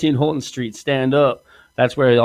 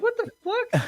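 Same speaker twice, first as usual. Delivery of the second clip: mock shocked squeak, much higher; shocked outburst; 5 dB quieter than usual. Retelling his reaction to her money demand, a mock shocked squeak. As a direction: fling it out in imitated disbelief.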